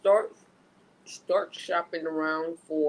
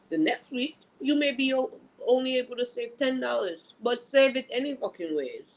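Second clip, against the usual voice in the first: insistent, higher and excited; emphatic; 7 semitones higher than usual. Real, not acted. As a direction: press the point home, pitch up. Emphatic advice rising.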